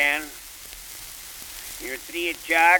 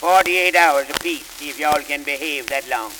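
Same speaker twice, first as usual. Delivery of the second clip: ordinary talk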